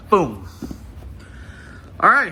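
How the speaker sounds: ordinary delivery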